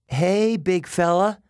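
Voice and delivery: nervous friendly call upward. Acted. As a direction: call up to the giant dog. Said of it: "I called up".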